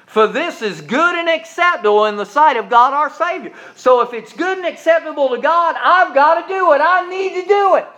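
high, loud, rising call; fervent pulpit build; preaching at full force. Acted. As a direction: climb toward the shout.

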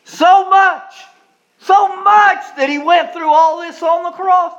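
shouted preaching climax. Acted. Preacher crying out to the congregation, loud and high. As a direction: cry it out at the peak of the sermon.

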